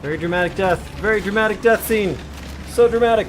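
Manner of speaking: over-the-top dramatic. Real, not acted. Mocking the boss's big death.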